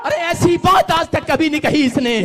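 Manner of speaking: ordinary delivery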